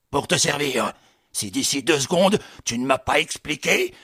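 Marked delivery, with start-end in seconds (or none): none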